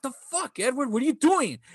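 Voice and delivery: sharp high shocked protest; startled high outcry. Retold or imitated. Kid knocked down by a neighbor, startled high outcry. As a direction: yelp it out, caught off guard.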